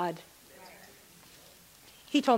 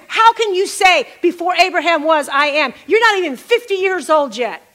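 higher and louder, indignant challenge; mock outrage; 6 semitones higher, 7 dB louder than usual. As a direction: throw the accusation back, incredulous.

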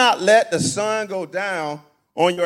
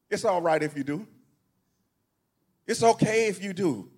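usual speaking voice

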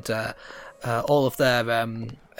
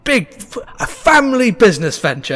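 loud, high, eager halfling hustler; excited, tumbling salesman patter; scheming halfling showman; 10 semitones higher, 9 dB louder than usual. Acted. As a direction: pitch a money-making show on the spot.

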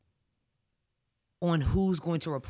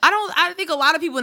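excited defense; 9 semitones higher, 9 dB louder, faster than usual. Real, not acted.

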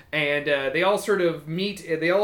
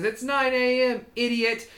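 another kobold; 7 semitones higher than usual. Acted. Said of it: "another kobold comes up and says".

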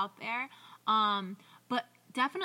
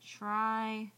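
drawn-out words with long gaps, soft on the letters; murmured note-to-self, word by word; writing it down; 5 dB quieter, much slower than usual. Real, not acted. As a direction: say it slowly as you jot a note. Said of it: "I'm currently writing this down".

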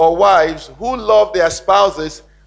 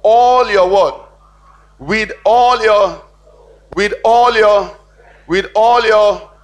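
"all" punched louder and slow, repeated; stress on ALL; 3 semitones higher, 5 dB louder, slower than usual. Shown as a demonstration. Preacher drives home "all" to the congregation, loud and slow. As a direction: hammer the word "all" each time. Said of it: "That's what it's saying".

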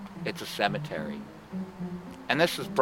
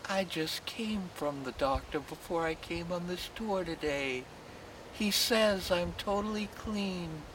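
a hint of a higher lady's voice, slowing at the end; lightly voiced lady; 9 semitones higher, 4 dB quieter than usual. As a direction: lightly play the happy old lady. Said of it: "the woman says to me".

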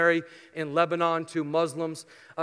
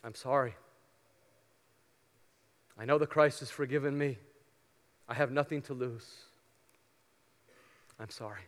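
soft, humble apology, dropping softer at the end; humbly; 4 semitones lower, 7 dB quieter than usual. Shown as a demonstration. Preacher shows Christians how to apologize, humble and soft. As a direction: say it gently, head bowed. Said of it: "and humbly say".

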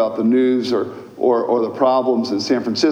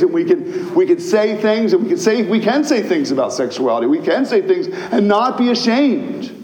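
preaching at full force; 7 semitones higher than usual. Acted. Pastor to the congregation, preaching at full force. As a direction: thunder it out with conviction.

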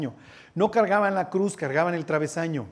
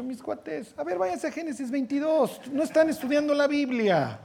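higher, teasing scolding of the guys; mock Bible-study leader; 7 semitones higher, 4 dB quieter than usual. Preacher mimics a study group leader scolding, mock tone. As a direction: playfully chide them to go read Genesis. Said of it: "Si hubiera estado alguien de G tres dieciséis".

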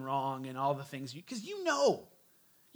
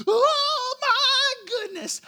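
much higher and louder, drawn-out slow panicked shriek; imitating a screaming mother, high, loud and slow; mock hysterical mother; 11 dB louder, much slower than usual. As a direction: shriek it like a mother watching the stroller roll away. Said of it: "it's like".

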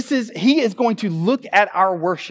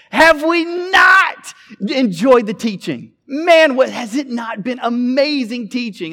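hyping the crowd; 9 semitones higher, 8 dB louder than usual. Retold or imitated. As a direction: keep baiting the audience to cheer. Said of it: "he's addressing thousands of people".

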